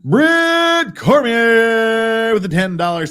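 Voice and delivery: slow, high, loud donation shout-out; announcer read. Acted. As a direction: call out the donor like an announcer. Reading a donation alert.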